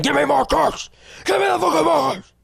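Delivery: high, strained tantrum shriek; hammed-up squealed child demand; marker kid. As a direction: imitate a demanding brat screaming. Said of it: "compared to marker kid".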